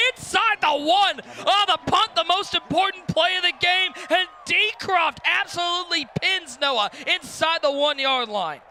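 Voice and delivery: loud, high commentator call, easing off at the end; excited play-by-play. Real, not acted. Live play-by-play of punt.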